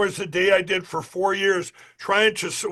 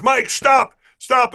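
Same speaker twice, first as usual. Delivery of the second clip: sharp raised call of stop, pitched higher and louder; a cop yelling at Mike to halt, louder and higher; retold shout; 6 semitones higher, 6 dB louder, slower than usual. Cop calling at a fleeing Mike to stop, raised retold shout.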